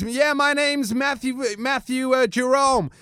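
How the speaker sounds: high-pitched imitated frat boy; frat-boy wrestler. Wrestler introducing himself on camera, high imitated voice. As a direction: slowly announce it as a hyped frat boy. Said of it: "And he goes".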